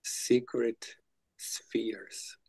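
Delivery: "Secret" whispered very slowly with no voice. Shown as a demonstration. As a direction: breathe out "Secret spheres" as a slow hush. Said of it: "Is it sacred or secret?".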